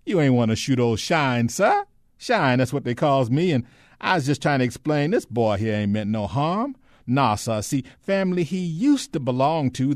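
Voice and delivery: high, loud, folksy Southern dialect voice; chattering, placating, humble old-servant act; ingratiating Shine figure. Acted. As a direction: talk the armed man down, eager and deferential. Ben plays servile Shine.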